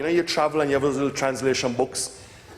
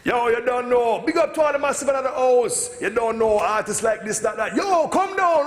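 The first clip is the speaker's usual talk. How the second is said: sound system MC; 12 semitones higher than usual. Retold or imitated. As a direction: hype up the crowd like a Japanese reggae MC. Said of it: "they get on the mic and they say".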